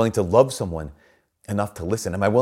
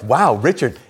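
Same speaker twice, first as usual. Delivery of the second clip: loud, raised-pitch imitation of coworkers; mock amazement; 5 semitones higher, 7 dB louder, slower than usual. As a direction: exclaim like the onlooking buddies. Retold coworkers reacting to Richard.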